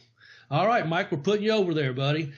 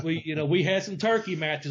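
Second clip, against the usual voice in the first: normal talking voice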